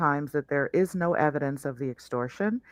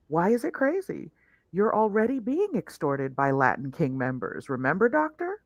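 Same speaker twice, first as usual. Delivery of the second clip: usual speaking voice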